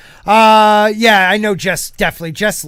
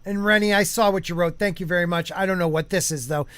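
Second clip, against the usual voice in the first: normal talking voice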